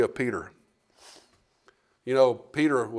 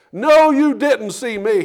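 slow, higher and louder, digging in; final denial; 8 semitones higher, 8 dB louder, slower than usual. Acted. Peter's third denial to the accuser, slow and loud. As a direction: spell out each word to shut it down.

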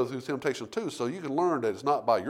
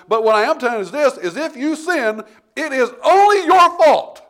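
louder, higher, hammering each line to the church; full-force preaching; 9 semitones higher, 12 dB louder than usual. Acted. Pastor driving the point home to the congregation, full-force preaching. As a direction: preach it hard, building to a cry.

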